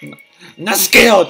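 loud, high insistence; shouted denial. Real, not acted. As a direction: yell that you are not scared. Shouts denial while panicking.

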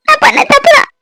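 the daughter repeats her confession to her mother, near wailing; rising tearful confession. Acted. Repeats confession, more desperate.

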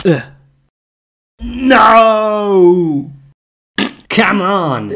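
loud, high cry of protest; frustrated shout. Real, not acted. Player yells at the game in real frustration.

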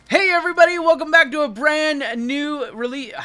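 loud, high YouTube host intro; big booming welcome to the viewers; Jabs. Acted. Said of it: "I gotta do the Jabs impression".

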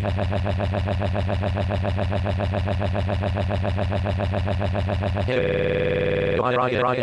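long, droning stuttered noise; monotonous machine-gun "ha-ha-ha"; imitated noise. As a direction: rattle off one syllable mechanically, on and on. Noise of the piano ride.

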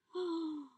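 quick gasp of delight. Real, not acted. Seeing cute items, delighted gasp.

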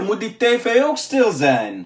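ordinary delivery

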